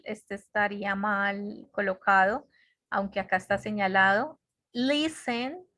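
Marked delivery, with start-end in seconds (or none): 4.7-5.7 higher "Listen", accent on LIS; stress on first syllable. Shown as a demonstration. Teacher showing where the accent is, "Listen" stressed on LIS. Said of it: "el acento está en, en la primera".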